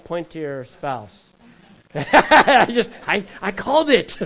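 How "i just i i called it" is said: loud burst of laughter; real laughter, much louder and higher; laughing. Real, not acted. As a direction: crack up at your own joke. Laughing at own joke.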